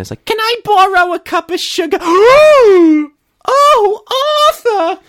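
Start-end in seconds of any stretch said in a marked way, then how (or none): 0.3-2.0 very high, loud caricature neighbor voice; visiting neighbor. Acted. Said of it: "the neighbor comes in". 2.0-3.1 very high, loud, hammed-up gasping squeal; mock shocked shriek. Neighbor's shocked reaction, acted. 3.4-5.0 high falsetto exclamation, hammed up; shocked neighbor. Acted shocked neighbor in sketch.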